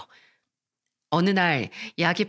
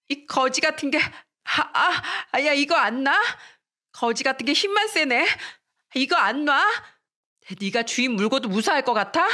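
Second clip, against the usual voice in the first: higher-pitched, indignant outburst; angry struggling; 6 semitones higher than usual. Acted. Girl held by her hair insults her classmate, angry. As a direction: spit insults mid-tussle.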